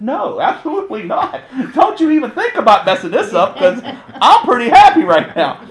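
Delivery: gleeful, don't spoil this; laughing refusal. Retold or imitated. Pastor laughingly tells wife not to call the ambulance.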